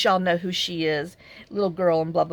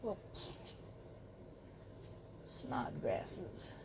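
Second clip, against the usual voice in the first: muttered aside; 7 semitones lower, 22 dB quieter, much slower than usual. Real, not acted. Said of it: "muttering to herself while crafting".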